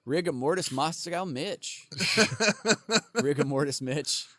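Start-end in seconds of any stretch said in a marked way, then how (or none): none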